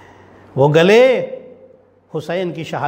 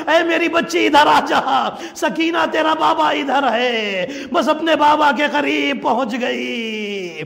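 high, keening chant-like mourning; wailing lament; 10 semitones higher than usual. Acted. Preacher telling mourners of Sakina's last journey, high wailing lament. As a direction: cry the story out at a sustained high pitch.

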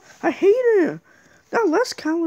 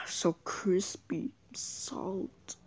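1 hushed savoring; 13 semitones lower, 16 dB quieter, much slower than usual. Pretend. Savoring fries.

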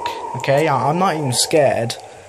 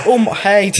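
sudden loud startled cry, higher; startled yelp; 6 semitones higher, 5 dB louder than usual. Real, not acted. Gamer jolted by a jump scare, startled yelp. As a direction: jump out of your skin and yelp. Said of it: "That actually genuinely scared me".

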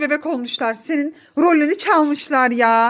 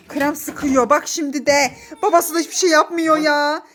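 ordinary talk, nothing marked

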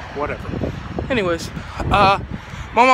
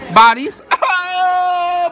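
mock shocked echo; 11 semitones higher, 8 dB louder, much slower than usual. Echoing the word playfully.

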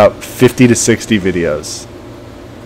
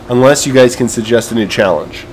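usual speaking voice

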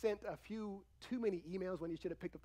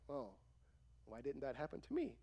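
softer, deflated mock envy; quietly sulky, put-on self-pity; acted envy; 4 semitones lower, 10 dB quieter than usual. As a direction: play let down by a friend's luck. Acts envy at a friend's news.

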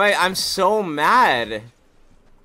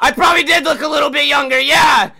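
heated rant; 12 semitones higher, 8 dB louder than usual. Real, not acted. Ranting about getting banned.

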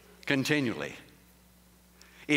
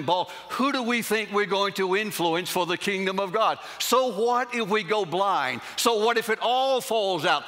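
full-force preaching; 4 semitones higher than usual. Acted. Preacher rousing congregation against complaining, full-force preaching.

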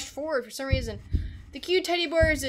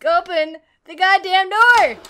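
forceful frustrated shout; angry yell; 4 semitones higher, 11 dB louder than usual. Real, not acted. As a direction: yell the command in exasperation. Second take, yelled at the game.

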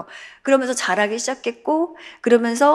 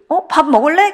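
bright surprised question; 8 semitones higher, 9 dB louder than usual. Retold or imitated. Mother answering child brightly.

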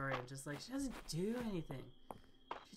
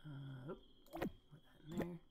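whispering; 11 dB quieter than usual. Real, not acted. Muttering while selling items.

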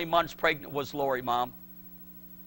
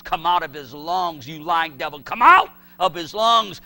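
commanding; 2 semitones higher, 10 dB louder than usual. Retold or imitated. Father walking with his choking son, shouting commands at the devil. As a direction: rebuke the devil at full voice. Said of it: "commanding it to come out".

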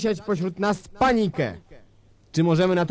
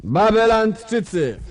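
slow, drawn-out call to the crowd; grand public hail; 9 semitones higher, slower than usual. Acted. Dictator greeting the crowd below the palace, grand. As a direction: call out from the balcony.

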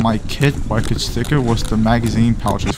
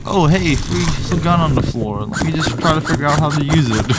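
mocking careless self; 5 semitones higher than usual.

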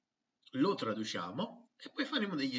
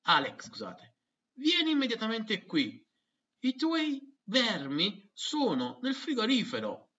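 higher mum's shouted call; mother shouting; 10 semitones higher, 5 dB louder than usual. Acted. Mother calling her son, in Italian. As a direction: yell for the kid across the house. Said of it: "lo chiama strillando".

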